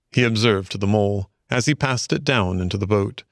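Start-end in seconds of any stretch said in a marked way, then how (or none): none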